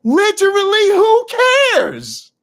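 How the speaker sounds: high, loud, slow and drawn out, fed up; exasperated outburst. Real, not acted. Biologist scoffing at the junk DNA debate, loud and exasperated. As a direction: throw up your hands in disbelief. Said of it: "exasperated outburst".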